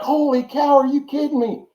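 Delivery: high, excited disbelief, imitating a friend; freaking out. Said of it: "he starts freaking out".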